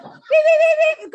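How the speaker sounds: very high, loud beeps; mock censor beeps. Player bleeps out the answer among laughing friends, high loud beeping. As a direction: squeal out a row of censor bleeps.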